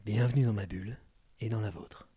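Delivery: low, smooth seductive voice; suave voice. Shown as a demonstration. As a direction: purr it like a femme fatale. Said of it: "tu peux prendre une voix suave".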